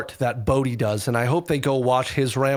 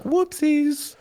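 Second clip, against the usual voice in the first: mock innocent oops. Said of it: "They're pretty loath to be like".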